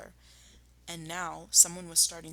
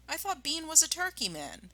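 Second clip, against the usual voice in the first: a curious little cub; young fox voice; 10 semitones higher than usual. Acted. The smallest fox asking his dad about turkeys.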